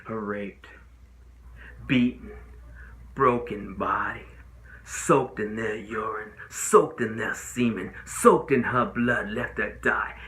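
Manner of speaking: slow, heavy phrases with pauses; grim, deliberate listing. Acted. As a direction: weigh down every word for the jury. Listing the victim's injuries to jury.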